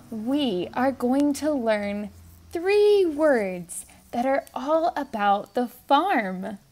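normal talking voice

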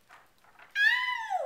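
high, loud cry of pain; storybook yelp, very high and loud; sudden "ow". Acted. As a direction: yelp as if biting on a sore tooth. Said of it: "she said".

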